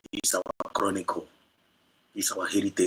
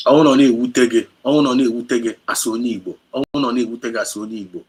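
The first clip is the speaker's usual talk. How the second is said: loud, fast, rising tirade; impassioned shout; 3 semitones higher, 11 dB louder, much faster than usual. Real, not acted. Broadcaster shouting his anger at listeners, fast and loud. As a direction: hurl it at the listeners.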